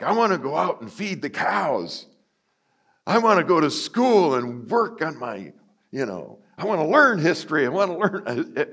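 put-on high, eager kid voice; mock eager child. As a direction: sound keen and bright as a keen schoolboy. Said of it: "I never felt like".

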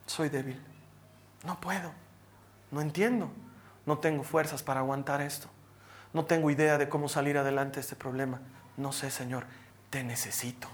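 low, hushed admission of weakness; humble confession. Acted. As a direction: confess it softly and low, as if praying. Humble confession to God in prayer. (confesión humilde en voz baja y grave)

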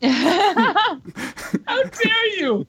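high, very drawn-out mock indignation; mock outrage. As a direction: stretch it out as if gravely insulted. Pretends outrage at insult to mom.

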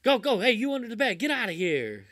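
hammed-up annoyed father; mock angry dad. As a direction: scold the kid's imaginary monsters. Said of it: "the dad's all pissed off".

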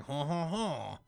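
a hammy French "ho ho ho" laugh; French vampire. Acted. Host mimics the French vampire from the comic, mock French accent. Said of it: "this French vampire is like".

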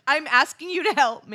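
high, laughing through the words. Real, not acted. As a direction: crack up while talking. Hysterical laughing at old memo.

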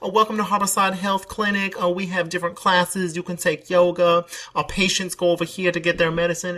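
high, evenly pitched mock greeter; clinic greeter. Clinic greeter welcoming a customer, mock high sing-song. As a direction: imitate a receptionist reciting the welcome, high-pitched.

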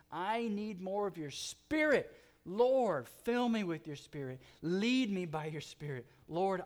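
loud, rising, pleading preacher's prayer; impassioned prayer. Acted.

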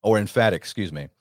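usual speaking voice

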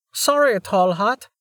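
put-on high, slow apologetic girl; a young girl calling out. A narrator's reading.

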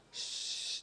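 unvoiced shush; a sharp hush to silence someone. Hushing a mentor.

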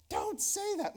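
high-pitched mock protest; playful imitation of someone objecting; mock objecting listener. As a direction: put on a high voice as a scandalized listener.

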